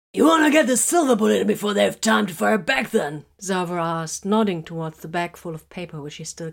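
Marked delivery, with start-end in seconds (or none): 0.1-3.3 raised, loud, wry soldier; a hint of a gruff soldier. A narrator's reading.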